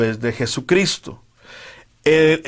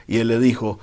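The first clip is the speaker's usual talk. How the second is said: ordinary delivery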